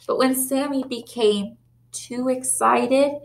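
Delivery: plain reading voice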